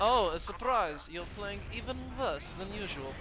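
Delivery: put-on hammy war movie German villain accent; slow, camp German baddie accent. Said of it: "my extremely camp German villain accent".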